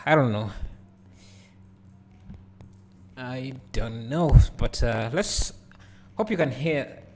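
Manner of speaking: crackly, distorted microphone sound; usb mic crackle. Real, not acted. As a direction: voice breaking up through a crackling mic. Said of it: "the mic is crackling".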